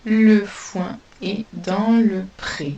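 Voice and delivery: slow, spaced reading of le foin est dans le pré; teacher repeating the sentence slowly for pupils; slow dictation. Shown as a demonstration. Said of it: "Je répète".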